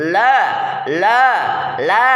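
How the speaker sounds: la, la, la sounded out crisply with the tongue tip; demonstrating la. Shown as a demonstration. Teacher models la for tajwid students, crisp. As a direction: drill "la" three times, clear and even. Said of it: "Ah, gitu ya".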